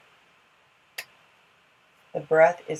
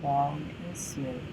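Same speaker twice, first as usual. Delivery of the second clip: guided yoga instruction; 4 dB quieter, faster than usual. Acted. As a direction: guide the breathing gently, unhurried.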